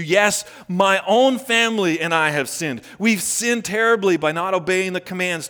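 earnest prayer read aloud, swelling in the middle. Acted. Pastor voicing Nehemiah's prayer, earnest. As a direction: pray it as Nehemiah would.

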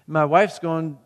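ordinary talk, nothing marked

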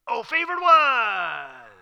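high, loud and very slow "Oh, favored one"; game-show style proclamation; grand announcement. Shown as a demonstration. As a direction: stretch it out like a showman. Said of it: "like he's gonna surprise her".

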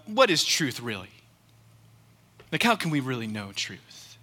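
a hint of dismissive scoffing; insincere. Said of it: "approach that question insincerely".

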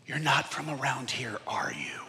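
hushed, doubtful aside; sceptical Peter. Acted. Peter quietly doubting Jesus. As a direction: Peter muttering low and quiet, not buying it. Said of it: "I can imagine Peter go".

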